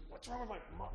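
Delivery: high, puzzled voice; confused warlock. Retold or imitated. Warlock confused mid-spell.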